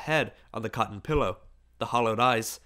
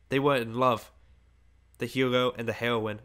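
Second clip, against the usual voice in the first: usual speaking voice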